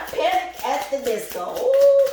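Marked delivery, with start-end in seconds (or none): 1.6-2.1 high, loud ooh of surprise; a loud, high, amazed ooh; surprised ooh. Real, not acted. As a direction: react with a big high ooh. Reacting to a card.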